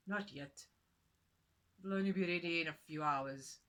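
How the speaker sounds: a plain-spoken husband; husband's voice. Acted. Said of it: "he answered".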